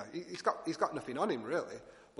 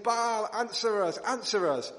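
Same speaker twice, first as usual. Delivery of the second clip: louder, higher, slow cry to Baal; desperate calling out; 7 semitones higher, 7 dB louder, slower than usual. Retold or imitated. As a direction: call out to the god and beg for an answer. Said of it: "they're saying".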